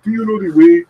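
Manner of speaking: high, loud mock Knuckles accent; Ugandan Knuckles accent. Millionth Knuckles asking for directions, loud meme accent. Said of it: "millionth fucking Knuckles, he was like".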